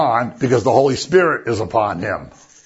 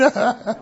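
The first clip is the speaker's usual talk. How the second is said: short high laugh; 9 semitones higher than usual. Real, not acted. Laughing after the Mary quote.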